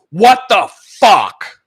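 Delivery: loud, drawn-out, stunned boss anger; each word hammered out slowly; furious slow bark. Retold or imitated. Boss glaring at the coder who broke the site, drawn-out furious. CTO confronts him at office.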